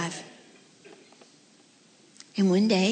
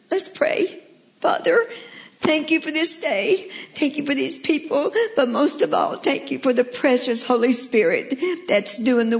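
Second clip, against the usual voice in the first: high, emotional voice of prayer; heartfelt prayer; 7 semitones higher, 5 dB louder than usual. Real, not acted. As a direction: pour out thanks to God, near tears. Closing prayer, moved.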